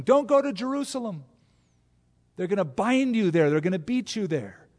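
high, loud warning; urgent warning. Acted. Prophet warning Paul against Jerusalem, high and loud. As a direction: plead with him not to go.

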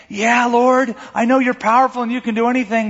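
protesting Martha. Retold or imitated. Voicing Martha's half-faith.

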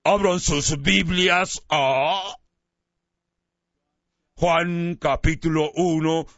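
a cheerful pastor opening Sunday's Bible study; Chuck Smith, smiling. Retold or imitated. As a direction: announce the reading with a smile, drawn out. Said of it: "con toda su sonrisa".

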